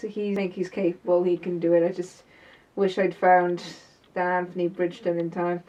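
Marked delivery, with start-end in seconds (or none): none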